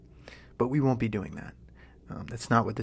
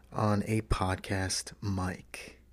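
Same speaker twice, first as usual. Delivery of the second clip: right in your ear; 3 semitones lower, much slower than usual. Shown as a demonstration. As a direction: ease into the mic slowly. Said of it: "it's like I'm whispering in your ear".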